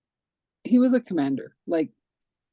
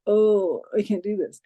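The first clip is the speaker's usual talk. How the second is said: louder, higher exasperated "oh"; mock overwhelm; 4 semitones higher, 5 dB louder than usual. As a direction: act out having had enough, mildly dramatized. Acts out overwhelm in crazy meeting.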